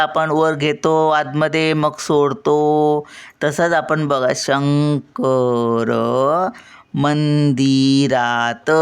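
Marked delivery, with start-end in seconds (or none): 4.4-8.7 "शंकर मंदिरात," drawn out very slowly, "शं" and "मं" held then released; उचलून मग सोडून. Shown as a demonstration. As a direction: lift each nasal in "Shankar मंदिरात," like a breath, then let it down. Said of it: "मनला उचललं". ("शंकर मंदिरात," शं, मं उचलून सावकाश सोडा)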